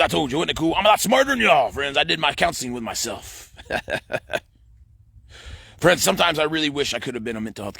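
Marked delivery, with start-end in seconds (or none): 3.6-4.4 voiceless, wheezy little laugh; short chuckle. Real, not acted. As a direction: chuckle at your own joke. Laughs after joking about self-counseling.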